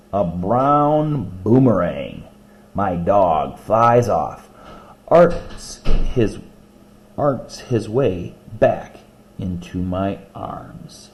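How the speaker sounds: slow, deliberate poem reading. Acted. Teacher reading a dog poem to class, slow and rhythmic. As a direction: savor each image slowly.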